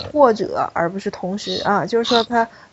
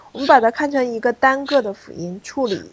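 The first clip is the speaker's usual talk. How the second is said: ordinary talk, nothing marked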